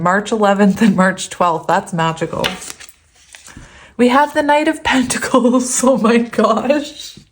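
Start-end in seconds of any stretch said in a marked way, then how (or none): 4.0-7.3 higher, laughing exclamation at the card; delighted disbelief at the draw; amused surprise. Real, not acted. Reacts to a slow card.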